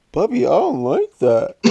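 high, loud sing-song copy of his sister; hammed-up whiny taunt; mocking kid. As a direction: parrot her, laughing. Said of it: "He'll make fun of you".

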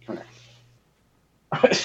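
breathy secret whisper, leaning close. Real, not acted. Tells daughter a secret.

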